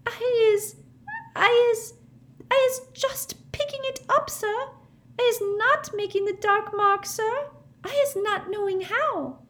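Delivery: very high, squeaky tearful squeal; the elf squeals her innocence in tears; squealing house-elf. A narrator's reading. Winky pleading with Mister Diggory, high tearful squeal. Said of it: "squealed Winky".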